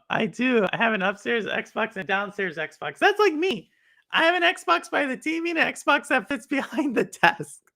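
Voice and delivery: usual speaking voice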